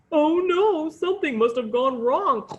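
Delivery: loud pig-voiced dismay; oinking pig. Pig prince realises the spell failed, loud piggy dismay. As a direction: squeal it like a flustered pig. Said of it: "oinked the pig prince".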